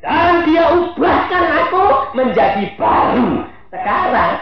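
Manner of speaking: high, loud and slow proclaiming; triumphant shout. Acted. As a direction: shout the victory. Climactic testimony.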